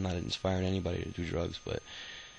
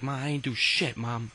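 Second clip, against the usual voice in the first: higher, louder pleading to mom; imitated indignant kid's denial; acted protest; 6 semitones higher, 5 dB louder than usual. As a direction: act out protesting innocence to your mother. Acted protest to mother on speakerphone.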